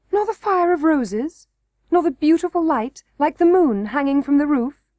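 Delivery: insisting princess. A narrator's reading. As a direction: point out the wonders to him.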